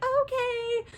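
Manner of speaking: very high meek okay; meek Circe. Retold or imitated. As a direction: give in sweetly. Circe gives in.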